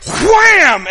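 loud, high shouted sound effect; shouted impact; wham. Retold or imitated. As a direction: slam it out. Arm slammed in story.

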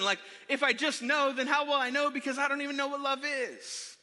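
put-on high-pitched, bewildered kid's complaint; exasperated protest. Kid baffled by mom's advice, exasperated protest. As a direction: build a baffled, exasperated argument.

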